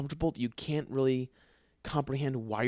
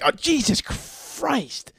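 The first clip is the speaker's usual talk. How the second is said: much higher, slow startled gasp of words; 13 semitones higher, 5 dB louder, much slower than usual. Retold or imitated. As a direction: jump out of your skin at night. Startled by wife in bathroom.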